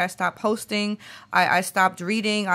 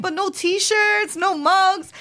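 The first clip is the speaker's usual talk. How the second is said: mock pleading; 11 semitones higher, 4 dB louder, slower than usual. Retold self haggling with friend.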